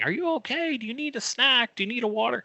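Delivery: high, fussy voices of worried coworkers; a high, pampering voice as if to a trapped child; fussing coworkers. Retold or imitated. Team voiced asking through door.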